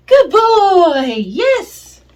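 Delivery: high, loud happy praise; excited praise. Real, not acted. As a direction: gush over the puppy. Praising dog enthusiastically.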